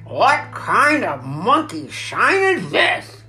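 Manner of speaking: loud, slow, indignant growl; disgusted growl. A narrator's reading. Rat tossed out of sleep, disgusted growl. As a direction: growl it out, drawn out and swooping. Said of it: "he growled".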